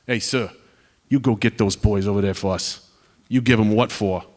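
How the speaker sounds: imitated New York local's accent; thick New York accent. New Yorker urging on an airman in a pizza place, thick New York accent. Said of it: "in his thick New York accent".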